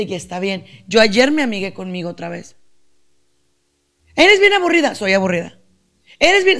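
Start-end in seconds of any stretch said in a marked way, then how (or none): 4.2-4.9 high, loud taunting voice; mocking critic. Speaker voicing critics who call her boring, loud and taunting. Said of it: "voicing critics". 6.2-6.6 high, loud taunting voice; mocking critic. Speaker voicing critics who call her boring, loud and taunting. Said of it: "voicing critics".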